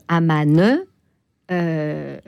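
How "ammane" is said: Amman with an added final E, "a-ma-neu"; old French pronunciation. Shown as a demonstration. How diplomats once said it, Amman with final E. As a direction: draw Amman out into a sounded final E. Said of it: "les diplomates et, et les Français".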